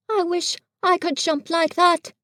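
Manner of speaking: Pooh, innocent and fumbling; Pooh bear voice. A narrator's reading. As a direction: play a guileless little bear. Pooh thinking aloud.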